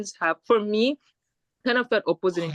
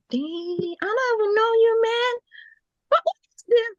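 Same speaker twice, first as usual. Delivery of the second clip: indignant disbelief; 14 semitones higher than usual. Retold or imitated. Retorting to rude classmate.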